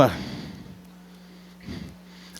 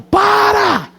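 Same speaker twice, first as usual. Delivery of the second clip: a father's alarmed yell, much higher; sudden shout; 9 semitones higher than usual. Retold or imitated. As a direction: yell Stop at the driver. Father yells at daughter driving. (grito de pai assustado)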